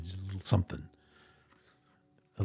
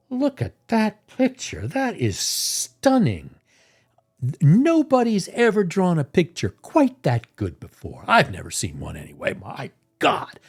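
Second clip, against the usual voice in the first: much higher, dreamy awe; mock gushing; 8 semitones higher than usual. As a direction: ham up the self-admiration. Gushing over own card.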